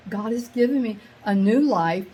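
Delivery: ordinary delivery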